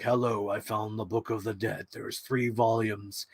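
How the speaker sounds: put-on low kid voice. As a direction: mumble it like the dull kid.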